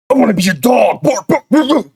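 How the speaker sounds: high, loud mock barking; hammed-up high doggy voice; begging dog character. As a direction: yap like an over-eager dog. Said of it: "I can get in a character for this".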